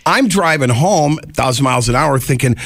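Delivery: ordinary delivery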